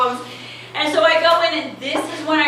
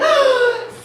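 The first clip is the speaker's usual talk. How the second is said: sharp waking gasp; 13 semitones higher than usual. Retold or imitated. Coming to after fainting.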